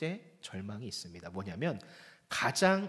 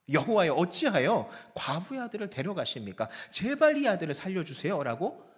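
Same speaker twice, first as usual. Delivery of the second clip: earnest prayer; 4 semitones higher, 6 dB louder than usual. Acted. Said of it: "간절하게 혼신을 다해서 기도를 하죠".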